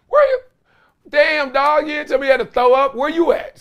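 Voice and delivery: high squeaky mock Lakers coach. Coach mocking the player who threw up, in a high squeaky voice. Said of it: "He was like".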